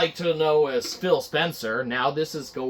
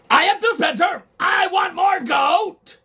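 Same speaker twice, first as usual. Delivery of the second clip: demanding Phil Spencer; 14 semitones higher, 5 dB louder than usual. Acted. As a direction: order more goat like a spoiled tyrant. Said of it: "We're gonna do a little reenactment".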